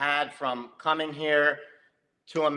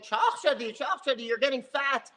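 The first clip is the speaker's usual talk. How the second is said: higher, teasing mom nag with a laugh; Persian mom voice; 9 semitones higher than usual. Retold or imitated. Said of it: "our moms are constantly saying".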